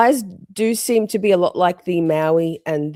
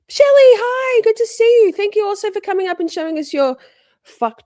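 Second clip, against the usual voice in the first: very high, loud gushing welcome; excited greeting; 7 dB louder than usual. Real, not acted. Streamer welcoming a friend who came up, excited. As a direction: call out to a friend in high-pitched delight. Spots a friend among viewers.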